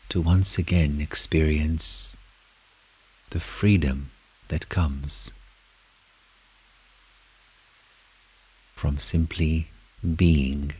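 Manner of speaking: soft, unhurried meditation voice with long pauses; guided meditation. Acted. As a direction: lead them gently inward, phrase by phrase. Leads a guided meditation in first person.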